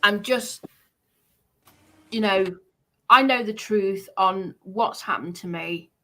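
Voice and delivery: ordinary talk, nothing marked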